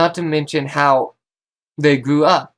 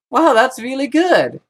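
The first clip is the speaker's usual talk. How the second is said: mock gushing fan; 10 semitones higher, 3 dB louder than usual.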